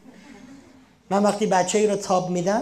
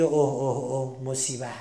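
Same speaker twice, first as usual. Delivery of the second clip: low, sinking groan of alarm; dread; 6 semitones lower, 3 dB quieter than usual. Retold or imitated. The mind reacting to a problem, low worried moan. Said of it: "ذهن ما میگه".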